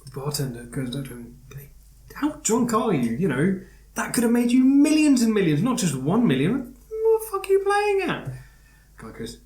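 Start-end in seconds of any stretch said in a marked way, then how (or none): 1.5-8.2 shouting barman, much higher and louder; angry barman. Retold or imitated. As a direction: yell at him in disbelief. Barman yelling at drunk customer.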